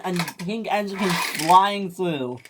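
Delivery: usual speaking voice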